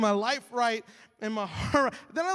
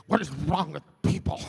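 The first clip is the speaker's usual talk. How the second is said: mock exasperated grumble, louder; 3 semitones lower, 5 dB louder than usual. Grumpy driver stuck behind someone, exasperated. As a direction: play a fed-up driver, strained and loud. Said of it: "I'm starting to grip the".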